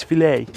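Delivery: "filet" said fi-LAY, French style. Shown as a demonstration. Fishing mate showing the French way, "filet" as fi-LAY. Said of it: "as they say in most parts of France".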